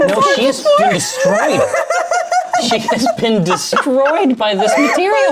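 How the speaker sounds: high, loud laughing shout; laughing hard. Real, not acted. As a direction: gasp it out mid-laugh. Laughing fit over friend.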